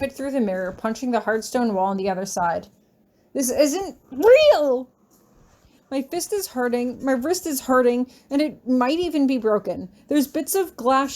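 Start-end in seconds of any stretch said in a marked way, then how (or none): 3.4-8.1 slow, loud, desperate cry; stretched-out frantic yell; panicked cry. Acted. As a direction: shout it out in panic. Panicked shout in fiction.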